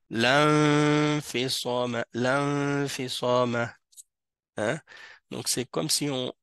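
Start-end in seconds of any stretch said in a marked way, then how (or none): 0.1-3.7 slow chanted "L'infisama", lan held in a long hum; la fused into noun. Shown as a demonstration. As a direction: recite "L'infisama" drawing out the nasal N. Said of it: "il se fusionne avec le noun directement".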